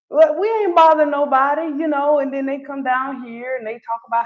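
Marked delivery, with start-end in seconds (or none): 0.1-1.6 higher and louder, indignant; raised mock protest; defensive local. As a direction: push back like you're minding your business. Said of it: "because I'm like".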